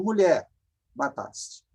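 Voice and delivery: usual speaking voice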